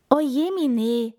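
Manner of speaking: high, loud cry of dismay. A narrator's reading. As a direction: exclaim in storybook dismay. Hedgehog alarmed by owner's warning.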